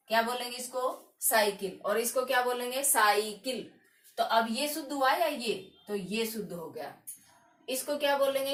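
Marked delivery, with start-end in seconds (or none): none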